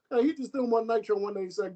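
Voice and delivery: normal talking voice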